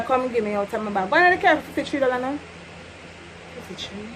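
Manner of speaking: ordinary talk, nothing marked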